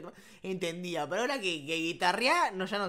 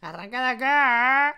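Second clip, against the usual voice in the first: put-on louder, slower street accent; mock tough-guy accent, drawn out; druggie barrio accent; 2 semitones higher, 9 dB louder than usual. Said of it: "hay que poner ese acento. El acento de falopa".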